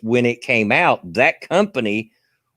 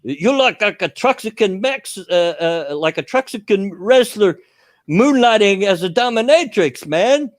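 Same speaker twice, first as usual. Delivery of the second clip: fake Russian accent; 11 semitones higher, 4 dB louder than usual. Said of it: "he's got this fake Russian accent".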